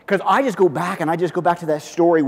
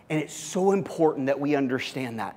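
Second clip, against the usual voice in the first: normal talking voice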